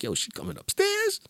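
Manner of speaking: high squeaky neighbor voice; nosy neighbor. Retold or imitated. Neighbor asking if the girl is going upstairs, squeaky and high. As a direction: squeak it like an amazed buddy. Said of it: "he was like".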